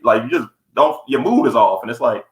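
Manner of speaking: normal talking voice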